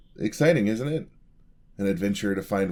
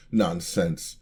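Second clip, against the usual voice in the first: forced laugh; 4 dB louder than usual. Acted. Brushing off being scared.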